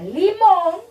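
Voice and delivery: "limón" stretched, weight on the final món; "limón" held long and emphatic; stress on limón. Shown as a demonstration. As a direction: draw out "limón" to show the rhyme. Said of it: "la rima está en la palabra".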